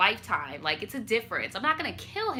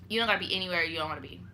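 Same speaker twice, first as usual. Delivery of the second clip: soft reassuring; 4 semitones lower, 6 dB quieter, much faster than usual. Real, not acted. Repeated advice softer.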